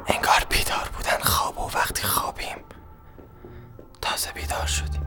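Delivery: unvoiced whisper with a catch of crying; hushed, broken whisper; tearful whisper. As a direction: whisper it as if about to cry. Inner voice whisper, cry.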